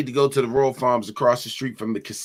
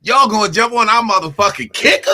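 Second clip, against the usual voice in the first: fired up; 9 semitones higher, 6 dB louder than usual. Real, not acted. Fired-up imagined fight.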